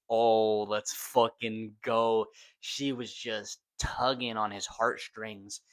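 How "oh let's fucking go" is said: loud, slow hype shout; excited cheer. Retold or imitated. A fan cheering at the screen, loud and slow. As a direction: cheer it out, dragging the words. Own excited reaction retold.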